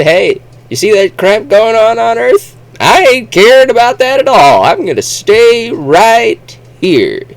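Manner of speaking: hammed-up folksy stubborn voice; Walter Grip. Walter Grip refusing to leave Mars, loud high folksy voice. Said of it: "he's the only one who said".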